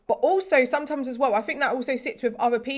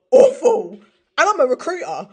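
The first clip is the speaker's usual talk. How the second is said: high, loud laugh breaking through "awful."; 7 semitones higher, 9 dB louder than usual. Real, not acted. Laughing at own Zoom dread.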